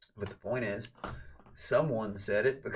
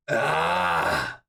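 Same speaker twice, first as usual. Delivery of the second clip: loud harsh gorilla cry; ape screech; 3 semitones lower, 8 dB louder than usual. Acted. Streamer mocking wannabe gorillas in a monkey world, ape screech. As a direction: let out one long, loud ape noise. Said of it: "be a gorilla in a monkey world".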